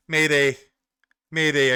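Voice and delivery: mock distress call. Mock calling for help.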